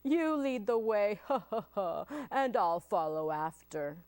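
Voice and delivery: hammed-up monster chuckle mid-sentence; bursting with laughter. Gruffalo mocks the mouse's boast, laughing. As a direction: break into "ha, ha, ha" while talking. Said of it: "bursting with laughter".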